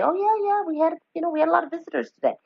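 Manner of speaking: high, quick, bright islander voice; a local answering a visitor's question, high and fast; cheery local islander. Retold or imitated. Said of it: "you to ask them, "Well, was it a busy day?".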